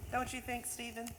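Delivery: usual speaking voice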